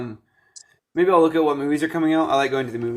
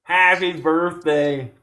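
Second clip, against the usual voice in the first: imitating the American accent; much slower than usual. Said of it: "in your best American accent".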